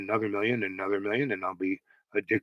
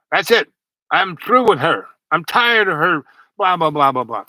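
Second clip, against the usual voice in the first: higher, louder fed-up outburst; angry banker; 6 semitones higher, 10 dB louder than usual. Retold or imitated. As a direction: mimic the father blowing up at lunch. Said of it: "and he gets mad".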